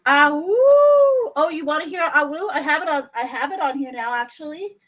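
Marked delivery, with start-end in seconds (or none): 0.1-1.3 high, loud playful howl; wolf howl. Pretend. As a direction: throw your head back and howl.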